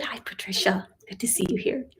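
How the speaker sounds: a soft, whispered greeting; whispered hello. Real, not acted. Teacher whispers a quick hello to a viewer mid-lesson.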